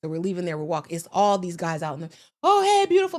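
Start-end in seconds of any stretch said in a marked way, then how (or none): 2.4-3.2 high, loud mock catcall; catcalling man. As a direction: call out like a street flirt. Catcalling men on the street.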